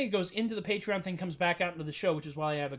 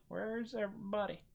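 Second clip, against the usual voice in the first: lonely shopkeeper; 2 semitones higher, 8 dB quieter than usual. Retold or imitated. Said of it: "a person standing in it going".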